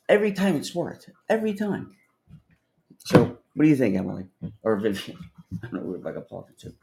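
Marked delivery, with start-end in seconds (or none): 0.1-1.9 proud insistence. Real, not acted. Boasting of the line.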